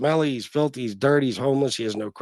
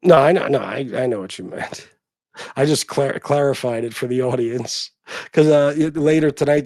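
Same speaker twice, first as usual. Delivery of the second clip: ordinary talk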